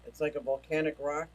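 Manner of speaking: ordinary talk, nothing marked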